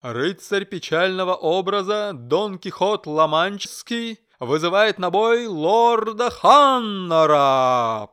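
slow, loud herald proclamation; booming herald. A narrator's reading. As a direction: announce it across the field. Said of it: "зычный голос Геральда".

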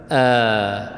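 آ held as one steady long "aa"; long madd vowel. Shown as a demonstration. Teacher showing students the madd letters, long held "aa". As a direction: stretch آ evenly, an open sustained vowel. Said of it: "بعكس المد".

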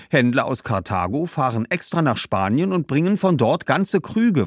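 hohe, laute Mädchenstimme, lebhaft erklärend; eifriges Mädchen. Acted. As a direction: preist stolz ihren Markt an. (Badbal erklärt den Garum, hohe Mädchenstimme)

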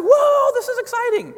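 very high, loud excited whoa; child amazed by big news; kids' whoa. Retold or imitated. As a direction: squeal it like a thrilled kid. Kids reacting to news.

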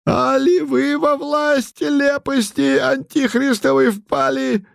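put-on high scolding old man voice; angry old man. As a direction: scold them like a furious old zealot. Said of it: "Путилин гневно потрясал палкой".

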